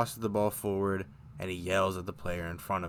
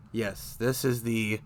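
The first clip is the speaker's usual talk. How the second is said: ordinary talk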